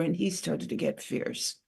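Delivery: ordinary delivery, nothing marked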